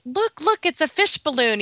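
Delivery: high, thrilled squeal of discovery; eager, childlike pointing out; excited. Retold or imitated. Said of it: "I got very excited".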